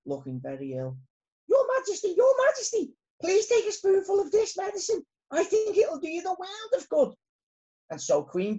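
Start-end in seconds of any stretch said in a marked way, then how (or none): 1.5-7.1 high, urgent character voice; squeaky, eager storybook subject; earnest little bee. A narrator's reading. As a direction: plead respectfully with the queen.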